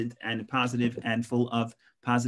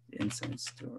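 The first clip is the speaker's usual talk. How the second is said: whispered title; 10 dB quieter than usual. Real, not acted. Reading a title to himself.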